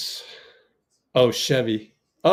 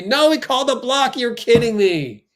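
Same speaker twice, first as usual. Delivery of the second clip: loud, high protest at the referee; you can't believe the call, so let it burst out; high, loud disbelief at a blocking foul; outraged disbelief at the call; 10 semitones higher, 4 dB louder than usual. Real, not acted.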